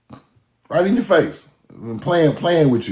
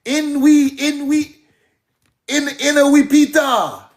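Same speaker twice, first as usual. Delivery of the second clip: mock Creole; 7 semitones higher than usual. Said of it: "We wasn't saying that shit".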